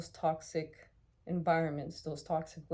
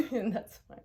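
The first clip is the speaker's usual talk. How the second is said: laughing; 6 semitones higher, 7 dB louder than usual. Real, not acted.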